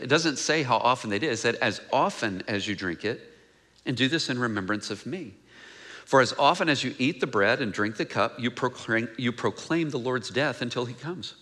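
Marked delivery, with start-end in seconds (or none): 1.6-11.3 lower, softer solemn reading of the passage; scripture reading. Acted. Reads the scripture passage aloud.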